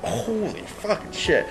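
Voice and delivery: slow, high, hushed amazement; retold awe. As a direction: draw it out softly in awe. Retelling awed reaction to fire show.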